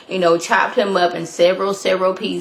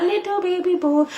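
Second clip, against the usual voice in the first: mock cooing; 10 semitones higher, 3 dB quieter than usual. Mallory voiced in recap.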